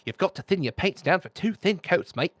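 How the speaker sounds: imitating a British painting host; British accent. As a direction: quote him in a British accent. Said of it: "you know, British accent".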